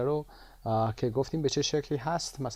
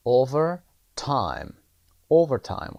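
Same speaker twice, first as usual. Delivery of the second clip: Overtime with the opening o much louder; stress on O; 6 dB louder, much slower than usual. Shown as a demonstration. Teacher demonstrates Overtime to students, stressing O. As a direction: punch the first o of Overtime. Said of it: "قسمت او".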